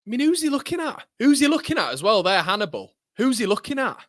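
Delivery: high, indignant demand; outraged disbelief. Real, not acted. Fan yelling at a player on screen, outraged.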